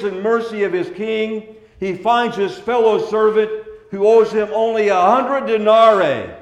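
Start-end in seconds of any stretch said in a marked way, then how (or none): none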